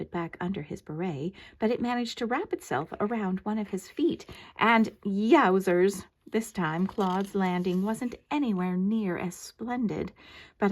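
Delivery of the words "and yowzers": drawn-out exclamation. A narrator's reading. Dramatic exclamation.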